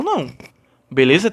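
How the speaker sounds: ordinary delivery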